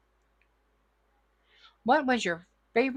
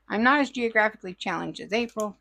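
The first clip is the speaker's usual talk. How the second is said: normal talking voice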